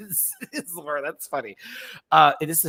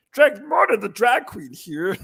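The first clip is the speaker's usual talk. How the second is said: drag queen voice; 5 semitones higher, 5 dB louder than usual. Retold or imitated. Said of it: "what do I sound like".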